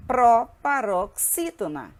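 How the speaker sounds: drawn-out, separated syllables; deliberate teacher drill; slow syllable-by-syllable. Shown as a demonstration. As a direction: hammer out each syllable slowly. Teacher sounds out the term.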